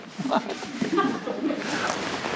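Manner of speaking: breathy laugh. Real, not acted. Laughs after Gehenna punchline.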